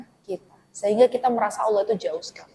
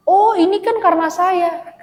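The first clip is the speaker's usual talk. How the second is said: mock boastful; 9 semitones higher, 6 dB louder, slower than usual. Mocking someone crediting their own effort.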